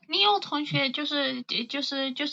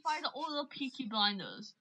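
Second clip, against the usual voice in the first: quiet, quick Birmingham accent; understated, offhand Brummie line; own Birmingham version; 9 dB quieter, faster than usual. Shown as a demonstration. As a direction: say it softly as a Birmingham local. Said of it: "Like, if I were to say it".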